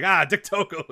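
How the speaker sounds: high, loud mock alarm; startled exclamation. Speaker imagines someone spotting Dick Togo, startled.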